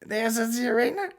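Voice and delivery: high, disbelieving bar guy; mock surprise. The bar guy hears about the arena shows, high and stunned. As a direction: gasp out the venue name. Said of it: "And he goes".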